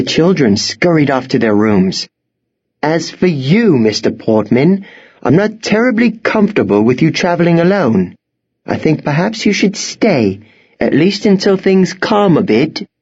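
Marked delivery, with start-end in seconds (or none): 2.8-12.9 high, proper headmistress voice; headmistress, firmly concerned. A narrator's reading. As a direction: advise him firmly to stay.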